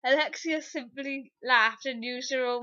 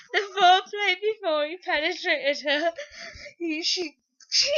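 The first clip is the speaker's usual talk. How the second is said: words squeezed out through giggles; real giggles breaking up the reading; laughing while reading; 6 semitones higher, 5 dB louder than usual. Real, not acted. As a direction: fight back the laughter. Reader laughing through lines.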